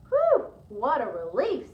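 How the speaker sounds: slow, loud relieved whew; relieved sigh. Acted. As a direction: breathe out the relief slowly. Woman relieved not dinosaur.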